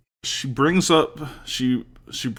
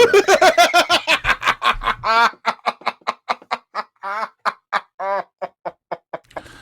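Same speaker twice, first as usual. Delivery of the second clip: long loud high-pitched burst of laughter; big high laugh; 13 semitones higher, 7 dB louder than usual. Real, not acted. Laughing hard at his cohost's Waffle House joke. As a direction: crack up helplessly, loud and high.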